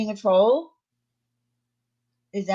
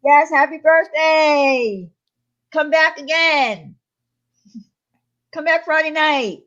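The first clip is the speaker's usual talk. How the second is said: high, loud, drawn-out birthday cheer; exuberant, stretched-out farewell shout; cheery send-off; 9 semitones higher, 7 dB louder, slower than usual. Real, not acted. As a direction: call it out big and slow, sing-out style. Calling out birthday wishes to chatter.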